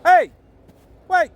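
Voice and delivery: loud call after the dog. Real, not acted. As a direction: call out to stop him. Dog runs off again.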